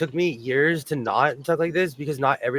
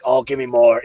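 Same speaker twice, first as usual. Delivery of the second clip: gleeful mock cheer, lower pitch, louder; egging on the fight from the cheap seats; eager onlooker; 5 semitones lower, 6 dB louder than usual. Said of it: "I'm just watching it like".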